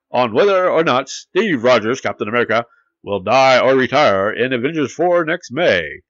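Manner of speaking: hammed-up old-time newsreel announcer; punchy, singsong forties newsflash read; 1940s news voice. Host reading movie news in his best 1940s news voice. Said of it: "read it in my best news voice".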